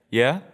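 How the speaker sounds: "Yeah?" lifting into a question; doubtful rising inflection. Real, not acted. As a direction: let "Yeah" rise at the end, unsure. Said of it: "The inflection".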